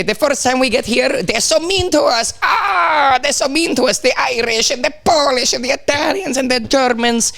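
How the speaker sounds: high-pitched mock lament over being mistreated; imitating aggrieved Italian immigrants; mock complaining. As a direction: wail it like a hard-done-by immigrant, hammed-up. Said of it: "Every Italian like to say".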